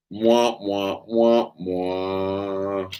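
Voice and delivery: dismissive droning wah wah; mock her talk as meaningless noise. Husband tuning out wife's talk about shoes, mock droning. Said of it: "I'm like".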